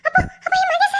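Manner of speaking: high, loud, tearful alarm; panicked, sobbing disbelief; frantic panic. Acted. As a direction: cry out in rising desperation for a loved one. Wakes, panics about Mahesh's fate.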